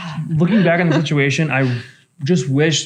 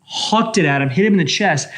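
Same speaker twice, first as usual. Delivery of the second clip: ordinary delivery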